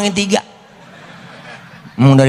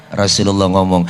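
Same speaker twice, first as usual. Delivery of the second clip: ordinary delivery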